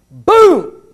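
loud, high imitated crash; crash sound. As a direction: punch the impact like a collision. Crash in retold accident.

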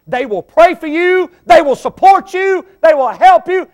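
loud, high, building list; preaching shout. Real, not acted. Preacher describing true friends, loud and high.

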